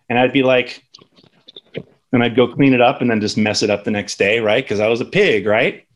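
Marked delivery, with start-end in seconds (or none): none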